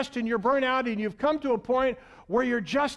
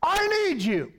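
urgent call; 8 semitones higher than usual. Acted. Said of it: "Jesus says".

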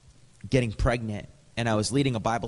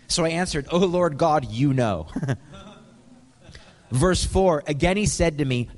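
louder, higher preaching of the verses; 5 semitones higher, 4 dB louder than usual. Acted. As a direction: proclaim it to the whole room. Preaching scripture at full force.

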